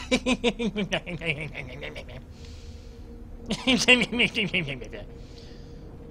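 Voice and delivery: long evil villain cackle; diabolical laugh. Pretend. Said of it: "C'est mon rire diabolique".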